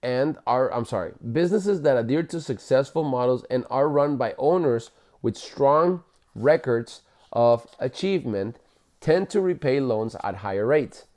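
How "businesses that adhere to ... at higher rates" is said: ordinary delivery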